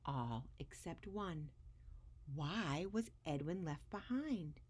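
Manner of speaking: put-on lively read-aloud voice for children; expressive storybook reading; story narration. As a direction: read it like a picture book to a class. Reads picture book aloud to kids.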